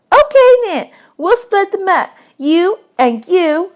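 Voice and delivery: put-on little girl voice. Desi quizzing new boys about dishes, high bossy girl voice. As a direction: boss the new boys around.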